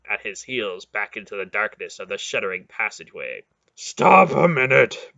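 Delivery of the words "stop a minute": gasping. Acted. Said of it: "Beric gasped".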